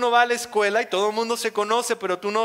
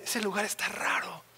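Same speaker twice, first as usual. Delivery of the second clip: wary mutter; 2 semitones lower, 10 dB quieter than usual. Retold or imitated. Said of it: "Gaby estaba así de".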